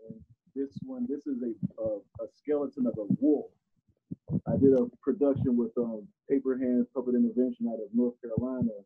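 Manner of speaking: covered microphone; muffled mic. Real, not acted. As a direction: finger over the mic. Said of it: "I think you're covering your mic".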